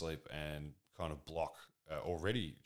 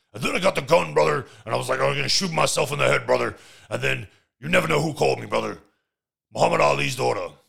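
much higher, much louder wrestler bellow; Hulk Hogan voice; 7 semitones higher, 15 dB louder than usual. Retold or imitated. Host retells the gun story and the phone call, in a full loud Hulk Hogan bellow. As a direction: yell the whole tale like a frantic wrestling promo. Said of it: "Sorry, I'm not doing the Hulk Hogan voice".

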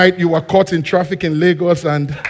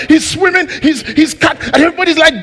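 ordinary talk

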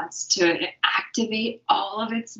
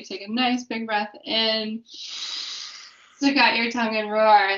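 guided breathing; 3 semitones higher, slower than usual. Acted. Leading the breathing exercise.

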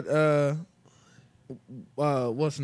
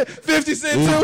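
laughing, loud, high ribbing; loud opinionated ribbing; 10 semitones higher, 6 dB louder, slower than usual. Real, not acted. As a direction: roast him while cracking up.

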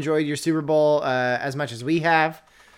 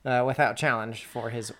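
ordinary talk

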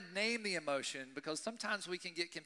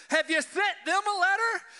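loud, high, accusing question; much louder and higher, mock outraged; indignant demand; 14 dB louder than usual. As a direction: challenge the HOA, fed up. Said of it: "So I'm just getting angry".

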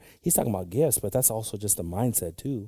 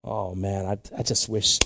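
usual speaking voice

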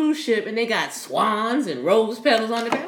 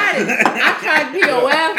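laughing; 6 semitones higher, 6 dB louder than usual. Real, not acted. Laughing confession.

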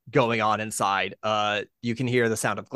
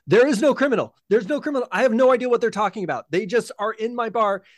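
high, loud, fast indignant denial; angry barkeep; 10 semitones higher, 4 dB louder, faster than usual. Acted. Minotaur barkeep shouting back at the officer, high and fed up. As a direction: insist there's no criminal, fed up.

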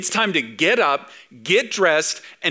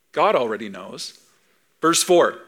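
ordinary talk, nothing marked

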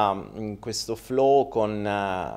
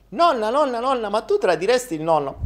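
put-on childish boy voice; 10 semitones higher than usual. Boy asks grandma.

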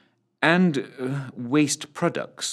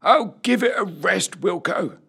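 put-on high-pitched old man's grumble; grumbling old corporal; 9 semitones higher than usual. A narrator's reading. Oldest corporal answering back to the sergeant, grumbling. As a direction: complain in a high old soldier's voice. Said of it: "muttered Corporal Ezra Longstocking".

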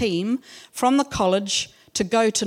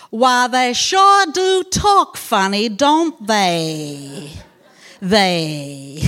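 mocking southern US drawl, louder and slower; southern US accent; 7 dB louder, much slower than usual. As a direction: put on a broad southern US accent, teasing. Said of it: "thinking about that southern accent".

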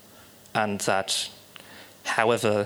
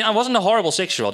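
ordinary talk, nothing marked

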